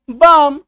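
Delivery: "बाम" loud, one long syllable with no L; silent L. Shown as a demonstration. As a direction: say "बाम" loudly, A straight into M. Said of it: "सही उच्चारण निकलेगा". ("बाम" में ल silent)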